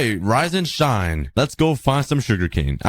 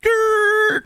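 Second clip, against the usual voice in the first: a high mock growl; playful growl. Gamer back home, letting out a high playful growl. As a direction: growl like a cartoon beast, for fun.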